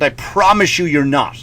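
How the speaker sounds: ordinary talk, nothing marked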